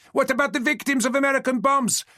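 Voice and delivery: high, loud yell across the hall; a heckler yelling as stewards approach, high and loud. Acted. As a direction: yell it over the moderator. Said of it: "she yelled".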